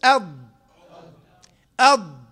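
loud "أض" with the heavy d held closed; isolated emphatic "أض" from the tongue's side; dad articulation. Shown as a demonstration. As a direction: punch "أض" and trap the air. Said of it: "قل".

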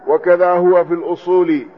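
ordinary delivery

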